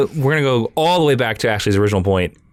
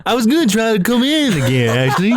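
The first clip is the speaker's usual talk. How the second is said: ordinary talk, nothing marked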